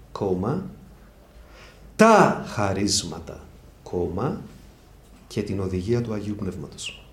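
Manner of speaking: slow dictation with punctuation read aloud. Shown as a demonstration. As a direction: dictate it word by word for notes. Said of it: "γράψτε".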